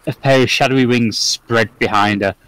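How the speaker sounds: ordinary talk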